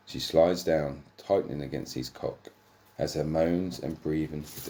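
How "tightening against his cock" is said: normal talking voice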